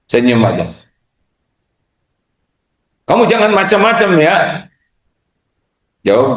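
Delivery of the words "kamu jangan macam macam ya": high, fast, picking a fight; mock angry retort. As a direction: snap back like a hothead. (balasan marah yang dibuat-buat)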